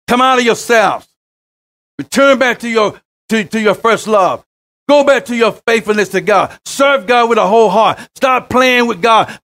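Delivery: fiery preacher calling the people back; preaching. Acted. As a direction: preach it out at full force. Preaching at full force.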